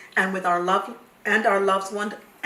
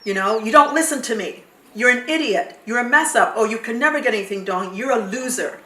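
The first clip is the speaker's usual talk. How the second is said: shaming mother yelling; 5 dB louder than usual. Retold or imitated. Mother shaming her child, louder angry scolding. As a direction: berate the child like a furious, contemptuous mother. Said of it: "your mother will shame you".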